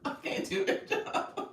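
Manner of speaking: real breathless laughing, voice nearly gone; too tickled to get the words out; helpless laughter. Real, not acted. Cracking up after voice attempt.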